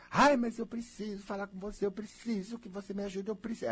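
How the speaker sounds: high whiny pleading; needy plea. Acted. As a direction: beg like someone clingy and needy. Imitando alguém carente pedindo ajuda. (súplica aguda e manhosa)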